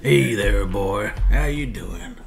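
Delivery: friendly cowboy hello, lightly put on; light cowboy greeting. Greeting a dog in a lightly done American cowboy voice. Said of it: "my Red Dead Arthur Morgan".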